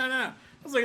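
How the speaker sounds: ordinary talk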